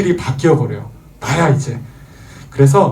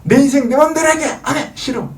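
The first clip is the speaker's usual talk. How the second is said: put-on much higher, childish tantrum cry; defiant toddler; 12 semitones higher than usual. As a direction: squeal it like a spoiled child. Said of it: "그 해석하면 무슨 말이죠?".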